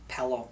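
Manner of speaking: "Pillow" said pellow, with an eh vowel. Shown as a demonstration. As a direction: say Pillow to rhyme with fellow. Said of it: "if you say pillow".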